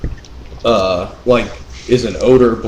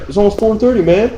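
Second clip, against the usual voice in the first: whiny coworker; 12 semitones higher than usual. Retold or imitated. Coworker whining at quitting time.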